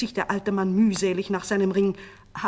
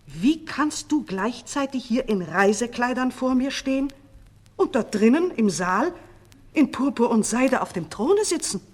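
higher, louder incredulous question; baffled astonishment; 6 semitones higher, 7 dB louder than usual. A narrator's reading. Minister to the doubled king, astonished. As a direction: press the impossible question in disbelief.

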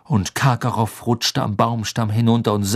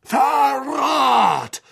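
high, full-throated shout; enraged high yell; furious roar; 14 semitones higher than usual. A narrator's reading. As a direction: bellow the accusation, stretching it out. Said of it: "brüllte er".

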